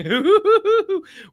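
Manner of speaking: a loud high laugh bursting out; laughing at his own plans, high and loud; high burst of laughter. Real, not acted. Laughing at himself.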